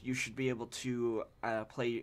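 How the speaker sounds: ordinary talk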